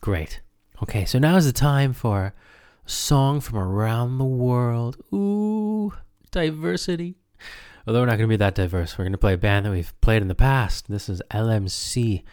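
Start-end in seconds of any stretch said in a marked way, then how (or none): 3.6-7.2 high, airy mock "ooh"; mock wonder. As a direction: gush over it ironically. Mock excitement about diversity.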